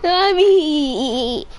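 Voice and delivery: fake crying. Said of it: "You said I'm fake crying?".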